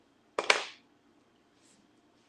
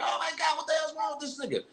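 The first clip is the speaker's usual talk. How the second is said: high shrieking mock panic, much higher; imitated scream; 13 semitones higher, 5 dB quieter than usual. Girlfriend running downstairs, shrieking in a high imitated panic. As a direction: shriek it like a woman who just found him.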